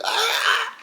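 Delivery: very high yelp of pain; mock pained yelp. Imitating the poked fighter, a high pained yelp. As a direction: squeal like you just got poked in the eye. Mimicking the eye-poked opponent.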